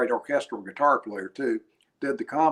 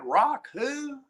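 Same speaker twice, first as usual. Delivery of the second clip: put-on much higher, puzzled hillbilly voice in East Tennessee accent; fake East Tennessee accent; 13 semitones higher than usual. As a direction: play dumb in East Tennessee twang. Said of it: "in this fake East Tennessee accent".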